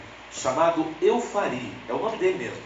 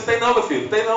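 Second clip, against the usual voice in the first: teasing nurse; 7 semitones higher, 5 dB louder than usual. Retold or imitated. Nutritionist voiced in story.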